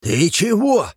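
the dwarf caught off guard; dwarf startled by the angry girl; taken aback. A narrator's reading. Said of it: "оторопел коротышка".

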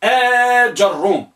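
آجروم slowly, long آ then ja; fatha on jim. Shown as a demonstration. Teacher repeating the fifth type, slow with open jim. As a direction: draw out آجروم as aa-jar-rum. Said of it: "بفتح الجيم".

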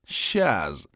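usual speaking voice

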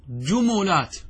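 جملات as "jumulaat", u after the m; middle vowel u. Shown as a demonstration. Teacher lists plural forms to students, جملات as "ju-mu-laat". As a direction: say جملات with a damma on the mim. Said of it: "بالضم".

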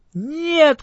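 high, sharp little shout. A narrator's reading. Right ants refuse to go home, high shout. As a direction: cry out a tiny no. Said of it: "кричат правые".

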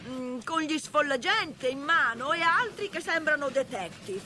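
high, agitated report; alarmed calling out. Acted. Engineer describes the armed pursuers to Toad, high and alarmed. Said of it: "gridò il macchinista".

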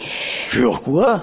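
irritated. A narrator's reading. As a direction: snap back in disbelief. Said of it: "d'une voix irritée".

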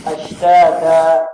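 أشتاتا with each alif held evenly, slow and loud; مد طبيعي; measured two-count length. Shown as a demonstration. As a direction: model the even length of أشتاتا. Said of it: "نضبط بها".

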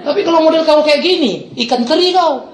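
high, emphatic put-down; retold scornful scolding. Pastor dismissing the thug as small fry, retold scorn.

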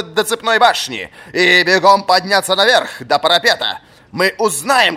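high, loud commanding voice; stern old master. A narrator's reading. Old fencing master ordering a punishing run. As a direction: lay out the punishment with relish.

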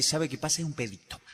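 a hint of a calm soft doctor. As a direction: soothe the patient gently.

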